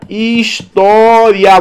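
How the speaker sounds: drawn out, syllable by syllable; slow teacher syllabifying. Shown as a demonstration. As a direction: spell out the syllables slowly. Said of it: "Vou separar as sílabas".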